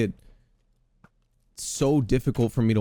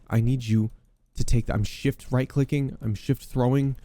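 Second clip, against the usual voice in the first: ordinary talk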